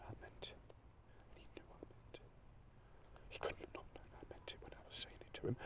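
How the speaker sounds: breath-only words, barely holding on; a broken, crying whisper; tearful whisper. Real, not acted. Emotional defence, breaking down.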